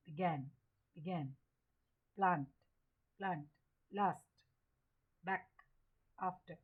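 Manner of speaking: word list with a short A in began, each dropped low; short A demo. Shown as a demonstration. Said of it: "ये है आपका short ए". (हर शब्द में छोटा ऐ, जैसे बैक)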